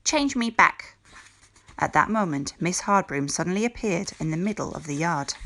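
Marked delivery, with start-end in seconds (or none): 0.0-1.0 voz alta y furiosa; exígelo con rabia; furious demand. A narrator's reading.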